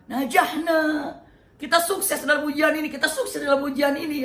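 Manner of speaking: high, loud, jubilant cry; joyful shout. Acted. Ibrahim crying out to his son that they passed, joyful and loud. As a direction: shout out the triumph. Ibrahim rejoices passing the test.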